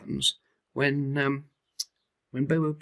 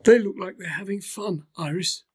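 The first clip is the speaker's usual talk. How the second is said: high, wistful little elephant voice; a lonely little calf looking on; young elephant Bobo; 9 semitones higher, 4 dB louder than usual. Acted.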